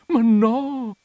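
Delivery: high, loud wail of protest; moaning. A narrator's reading. Pale countess protesting the misreading, moaning. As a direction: moan it in dismay. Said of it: "gemé".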